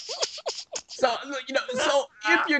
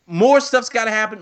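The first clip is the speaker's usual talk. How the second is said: ordinary talk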